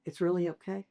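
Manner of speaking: soft, hushed reassuring voice; calm him down quietly; gentle reassurance. Retold or imitated. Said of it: "I can now look at him and go".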